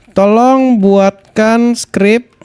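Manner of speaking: very slow, spaced-out words; slow dictation. Real, not acted. Streamer dictating a script prompt to ChatGPT, slow dictation. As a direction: dictate word by word at typing pace.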